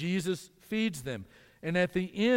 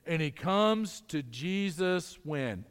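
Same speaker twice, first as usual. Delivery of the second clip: usual speaking voice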